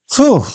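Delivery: high loud sputtering puff of breath; astonished splutter; spluttered phew. A narrator's reading. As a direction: blow the fluff away with a startled splutter. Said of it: "spluttered the astonished guard".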